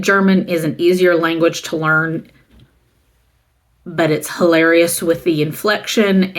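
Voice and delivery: normal talking voice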